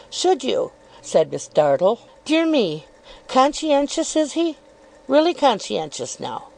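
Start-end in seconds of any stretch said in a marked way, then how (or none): 0.1-0.7 lightly raised, coyly probing voice; insinuating Miss Dartle. Acted. 2.2-6.4 lightly raised, coyly probing voice; insinuating Miss Dartle. Acted.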